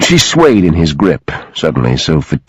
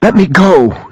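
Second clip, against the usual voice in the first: high, urgent plea; pleading; 12 semitones higher than usual. A narrator's reading. Sophie begging Garrett to release her, pleading. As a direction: plead to be let go. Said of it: "she pleaded".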